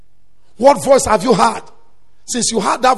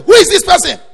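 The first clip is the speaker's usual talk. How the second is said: sudden loud, high shout; retold alarmed shout, much higher and louder; shouted challenge; 8 semitones higher, 7 dB louder than usual. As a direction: shout out as if catching an intruder. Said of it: "He now shouted".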